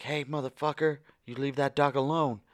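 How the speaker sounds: mock indignant shout; imitated confrontation. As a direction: call out a bully, retold. Couple confronting the dog owner.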